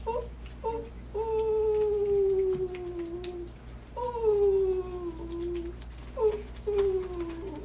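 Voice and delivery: mournful dove cry, high and full; crying dove coo. Acted. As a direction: wail out the dove's sore-handed moan. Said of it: "this is the way she sounded".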